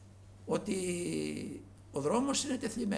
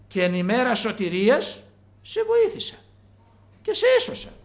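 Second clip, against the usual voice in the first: proclaiming loudly; 6 semitones higher, 11 dB louder than usual. Real, not acted. Preacher proclaims scripture.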